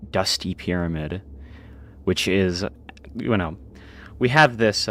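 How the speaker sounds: ordinary talk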